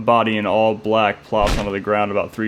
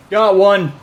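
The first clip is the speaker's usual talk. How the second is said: put-on high, loud young yell; 10 semitones higher, 8 dB louder than usual. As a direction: call it out triumphantly. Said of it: "a young voice yells".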